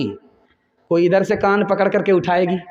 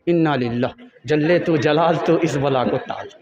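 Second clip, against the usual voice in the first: mock dread; 5 semitones lower than usual. Daughter describing her mother-in-law to her mother, low and woeful. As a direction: groan it like warding off a disaster.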